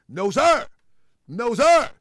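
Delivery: loud, firm refusal; loud no sir. Real, not acted. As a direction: shut him down hard. Scolding a doubter on stream.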